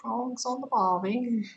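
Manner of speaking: slow, playful Aussie voice, drifting British; mock Australian accent. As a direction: say it like a bad Australian impression, slowly. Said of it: "You sound more British".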